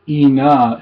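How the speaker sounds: ina, clean short i with no a glide before it. Shown as a demonstration. Teacher modelling ina for learners. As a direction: say ina starting straight on the i. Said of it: "It's not aina".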